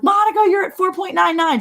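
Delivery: high excited sister voice; high thrilled sister. Retold or imitated. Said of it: "she's like".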